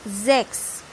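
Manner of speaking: Zex with a buzzing z at the start; voiced initial S. Shown as a demonstration. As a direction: say Zex with a voiced first sound. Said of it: "then it pronounced as Z".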